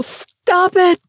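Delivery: loud, high-pitched desperate shout. A narrator's reading. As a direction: shout it out to make it end. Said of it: "she shouted".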